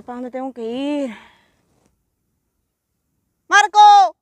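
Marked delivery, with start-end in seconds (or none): 3.5-4.2 high, full-volume playful shout; calling out. Pretend. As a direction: yell it far across a field. Calling out across the field.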